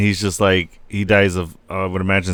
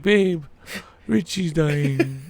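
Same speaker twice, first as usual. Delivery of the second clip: teary plea; 6 semitones higher, 6 dB quieter, much slower than usual. Retold or imitated. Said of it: "I'm like".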